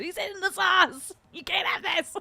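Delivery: high goofy voice; silly dog voice. Retold or imitated. Repeating the family joke about the dog, in a goofy high voice. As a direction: squeak it like a cartoon. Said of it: "That was the big one".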